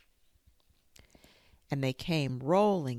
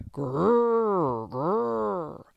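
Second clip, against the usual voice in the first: two loud angry growls; tiger growls; 5 semitones higher, 7 dB louder than usual. A narrator's reading. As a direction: growl through clenched teeth like tigers. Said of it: "they could only say".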